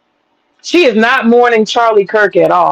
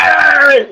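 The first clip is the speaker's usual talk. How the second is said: mock little dog snarl, higher; chihuahua bark; 6 semitones higher than usual. Comparing herself to little chihuahuas, mock snarl. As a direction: snap like a tiny angry chihuahua. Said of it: "You know how they be going".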